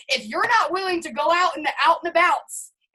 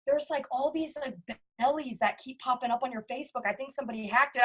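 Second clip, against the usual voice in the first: hesitant worried friend; 2 semitones lower, 8 dB quieter than usual. Retold or imitated. Friend warning about hacked account.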